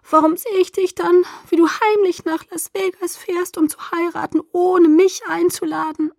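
high-pitched wounded accusation; plaintive, aggrieved complaint; hurt reproach. A narrator's reading. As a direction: accuse a friend, voice held high throughout. Hurt friend reproaches narrator.

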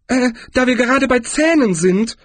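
high-pitched tourist character voice; high voice, opening on a hesitant "Äh"; Zweiblum. A narrator's reading. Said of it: "warf Zweiblum ein".

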